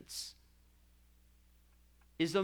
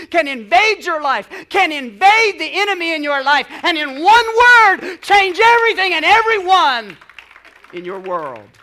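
loud, rising build to a full shout; building preaching fervour; 5 semitones higher, 14 dB louder than usual. Real, not acted. Preacher telling congregation of God's power, building fervent shout. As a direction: preach it harder and higher, peaking on the last words.

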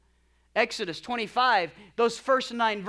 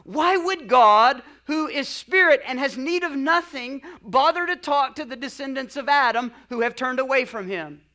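raised, emphatic reading of heartfelt questions; passionate reading; 5 semitones higher, 9 dB louder than usual. Acted. Preacher quoting a theologian's questions to congregation, impassioned. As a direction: read it with passion, stretching the opening words. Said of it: "he writes with a passionate heart".

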